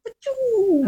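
high toy rocket noise; rocket blast noise. Retold or imitated. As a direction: pop the rocket sound way up. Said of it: "a little Boba Fett that goes".

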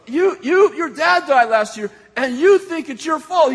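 high, loud re-enacted accusation; acted confrontation. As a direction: blurt it out, stumbling at the start. Re-enacts confronting the man, stammering.

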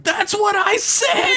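very high, drawn-out indignant squawk; hammed-up high-pitched outburst; exasperated Boimler. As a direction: squeal it in comic protest, stretching the words.

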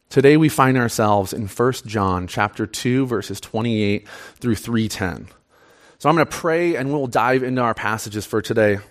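ordinary talk

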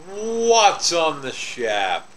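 slow, stretched segment intro; drawn-out catchphrase. Pretend. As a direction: draw out WHAT'S slowly before "on the shap".

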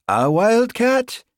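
loud, high, drawn-out Highland question; interrupting. A narrator's reading. Sergeant cutting off constable about cat, interrupting. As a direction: cut in with loud incredulity. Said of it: "Hamish interrupted".

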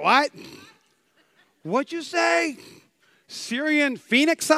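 high-pitched, rising mock disbelief; exaggerated confused questioning; baffled reaction. Preacher playing a listener puzzled by old language, high baffled questioning. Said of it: "you're like".

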